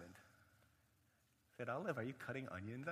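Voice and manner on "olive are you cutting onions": hushed, tentative check-in; concerned question. Retold or imitated. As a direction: ask again gently, the joke gone. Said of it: "She was silent".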